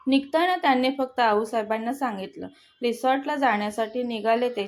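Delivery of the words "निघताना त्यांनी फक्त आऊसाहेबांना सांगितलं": ordinary delivery, nothing marked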